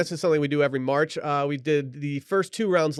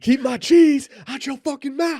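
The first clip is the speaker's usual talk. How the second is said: high, loud, drawn-out parody outburst; mock enraged shout; 11 semitones higher, 9 dB louder than usual. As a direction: yell it slowly as an over-the-top threat. Playing goat parodying Oscars slap outburst.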